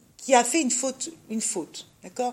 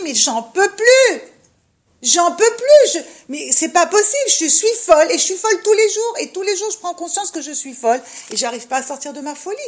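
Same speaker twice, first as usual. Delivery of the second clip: higher and louder, exasperated despair; despairing inner voice; 7 semitones higher, 9 dB louder than usual. Retold or imitated. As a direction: voice the woman at the end of her rope, half-laughing. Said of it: "Tu te dis". (voix intérieure désespérée, plus aiguë et plus forte, avec un rire nerveux)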